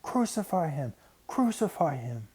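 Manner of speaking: crowd screaming. A narrator's reading. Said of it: "they screamed out and over and over again".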